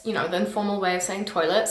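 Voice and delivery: normal talking voice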